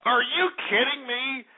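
usual speaking voice

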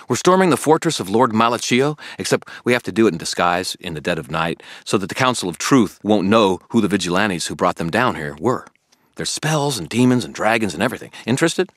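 a puzzled, keen friend pressing Harry; Billy the eager friend. Acted. Billy questioning Harry about the toads, puzzled and keen.

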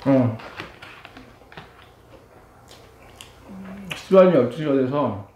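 muffled words between bites; talking while chewing. Real, not acted. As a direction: mouth full of noodles. Seen 4: Cheeks bulge, jaw chewing noodles throughout the labelled speech.

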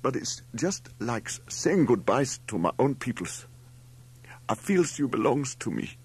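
put-on high, tearful foreign-accented farewell; sobbing. A narrator's reading. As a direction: plead through acted sobs. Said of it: "he sobbed".

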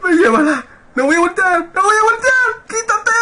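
very high screaming; frantic high-pitched panic; panicked shrieks. Real, not acted. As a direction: shriek as if it's right behind you. Chased by the monster.

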